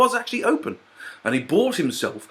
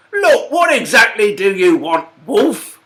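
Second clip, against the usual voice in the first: higher, louder piping challenge; piped up; 7 semitones higher, 5 dB louder than usual. Acted. Pig challenging the tired wolf, piping up higher. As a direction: pipe up boldly at the wolf. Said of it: "piped up the pig".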